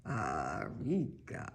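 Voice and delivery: slow, low, soft fake German accent; attempted German accent. Shown as a demonstration. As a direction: draw it out deep in a mock German accent. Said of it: "Sounds more like a Spanish accent".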